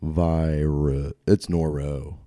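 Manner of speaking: normal talking voice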